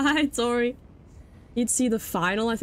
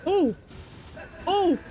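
real fright, yelped out high; startled yelp; 10 semitones higher than usual. Real, not acted. Gamer startled by the chaser, sharp yelps.

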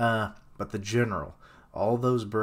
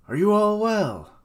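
high, loud hail; calling out; 10 semitones higher, 7 dB louder than usual. Acted. Commander checks on the fleet, calling out loudly. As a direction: call across to the other ships.

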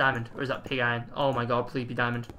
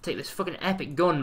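ordinary delivery

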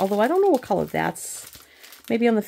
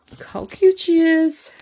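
gushing; 11 semitones higher, 8 dB louder than usual. Real, not acted. Gushing over the picture.

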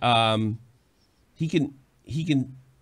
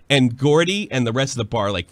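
ordinary talk, nothing marked

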